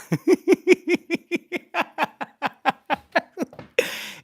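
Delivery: bursting into a high, loud laugh; loud high laughter. Real, not acted. To the chat, cracking up over bruh.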